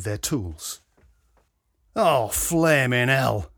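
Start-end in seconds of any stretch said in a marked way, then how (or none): 2.0-3.5 swearing. A narrator's reading. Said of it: "swore Alex".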